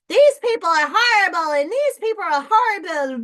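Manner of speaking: high, loud mocking whine, hammed up; mock the people shouting each other down; mocking partisan rant. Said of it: "Instead, it's like".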